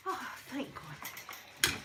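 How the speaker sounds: slow breathy thank God, barely voiced; whispered relief. Real, not acted. Relief over daughter.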